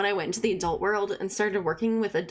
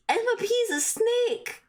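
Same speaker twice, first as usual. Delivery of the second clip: gasp, then a higher, slow "Emma P's a snake"; outraged disbelief, pitched up; shocked gasp; 13 semitones higher, slower than usual. Acted. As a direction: gasp and spit the insult out slowly. Gasp at betrayal.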